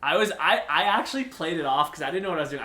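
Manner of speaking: ordinary talk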